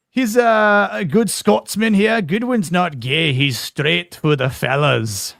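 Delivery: put-on loud, high, drawn-out Scottish accent. Host improvises on the spot for Melvin, loud Scottish accent. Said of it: "I hope that was a decent Scottish accent".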